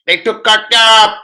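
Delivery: loud, high shout of disbelief; yelled high and loud in shock; excited outburst. Real, not acted. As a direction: blurt it out loud, stunned. Sudden outburst about a transfer.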